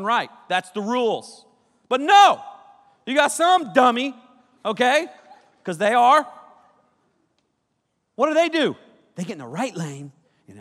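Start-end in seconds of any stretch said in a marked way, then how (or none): 1.9-8.7 much higher and louder rant; mock outrage. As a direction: blow up about the dummies. Ranting about line cutters.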